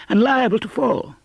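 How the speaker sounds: put-on old man pleading he may fall; remonstrating. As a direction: protest in fright. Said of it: "Scrooge remonstrated".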